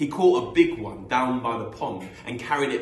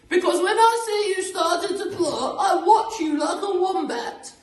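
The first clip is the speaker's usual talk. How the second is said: loud, very high falsetto threat; Missus Twit; 9 dB louder than usual. Acted. Missus Twit warning her plotting husband, screechy falsetto.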